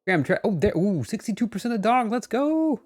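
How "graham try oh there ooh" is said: usual speaking voice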